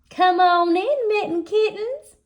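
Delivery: high, loud motherly call. A narrator's reading. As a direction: call the little ones in from outside. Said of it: "called out Mama Junebug Jones".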